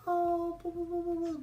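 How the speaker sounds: put-on high cooing baby talk; cooing nurse. Nurse cooing over the baby, high baby talk. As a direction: coo to the baby. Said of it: "the Chinese lady is like".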